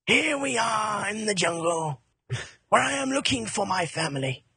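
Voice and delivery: stately, hammed-up nature narration; David Attenborough. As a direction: present it like a wildlife film, tiger-style. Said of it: "Like David Attenborough".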